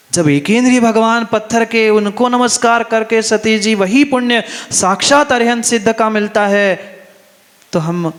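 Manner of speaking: high-pitched, loud exhortation; fervent sermon at full force; impassioned preaching. Real, not acted. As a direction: lift the voice high and proclaim it to the congregation.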